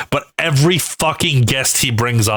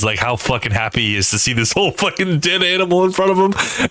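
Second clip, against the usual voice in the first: ordinary talk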